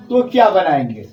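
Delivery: ordinary talk, nothing marked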